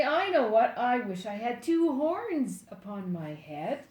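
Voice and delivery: ordinary delivery, nothing marked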